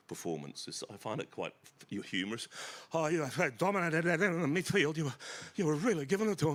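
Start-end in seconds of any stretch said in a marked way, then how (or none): 2.9-6.5 higher, louder, fast fawning praise; gushing ex-footballer. Retold or imitated. Mocking an ex-footy player gushing at a young footballer, loud and high. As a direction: fawn over the young player.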